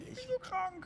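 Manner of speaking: very high, squeaky put-on whine; mock sick whine. Teasing his bandmate about his cold, high mock whine. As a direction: mimic a friend moaning he's ill. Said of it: "hat gemeint".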